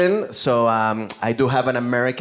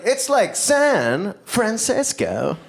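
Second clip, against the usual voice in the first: put-on higher, slow, patient American accent explanation; slow explaining; 9 semitones higher, much slower than usual. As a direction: spell it out slowly for the barista. Spelling his name for barista.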